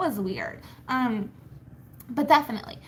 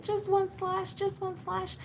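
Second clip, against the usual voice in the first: put-on high little-kid wheedling; high, small mock-child begging; imitating begging kids; 7 semitones higher than usual. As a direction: plead like a small child, pitched up. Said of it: "And they're like".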